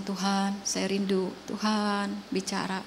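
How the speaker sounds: soft prayer. Retold or imitated. Retold quiet prayer in car.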